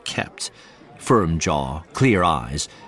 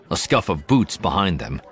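ordinary delivery, nothing marked

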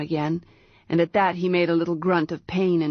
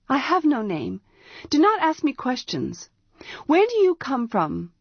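put-on stern young priestess; 7 semitones higher than usual. A narrator's reading. Priestess answering sharply.

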